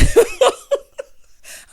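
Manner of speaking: very high, loud cackling laugh; real burst of laughter. Real, not acted. Podcaster loses it over the staged bed scene, cackling. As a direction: lose it in a big high laugh.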